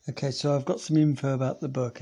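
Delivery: normal talking voice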